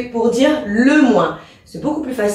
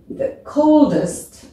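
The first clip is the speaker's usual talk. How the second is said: slow model; 4 semitones higher, much slower than usual. Shown as a demonstration. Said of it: "teacher models answer".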